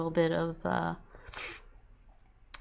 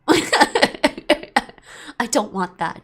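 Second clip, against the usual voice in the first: laughing refusal; 4 semitones higher, 8 dB louder, much slower than usual. Acted.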